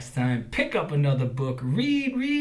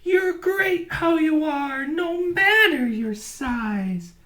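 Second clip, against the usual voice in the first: high kind voice, slowing at the end; smiling prairie dog; 13 semitones higher, 7 dB louder, slower than usual. A narrator's reading. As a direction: say it with a smile. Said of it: "a smile in her eyes".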